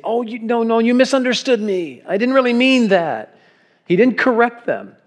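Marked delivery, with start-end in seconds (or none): 0.0-3.4 high, flustered backpedaling voice; mock protest. Preacher mocks what Jesus never said, high and flustered. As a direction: play a nervous Jesus taking it back. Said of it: "And Jesus didn't say".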